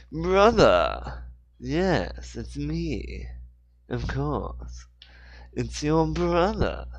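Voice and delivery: hammed-up British accent, slow at the end; Naked Snake British accent. Shown as a demonstration. Host tries the British accent for Joseph, hammed up. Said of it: "the classic, um, naked snake".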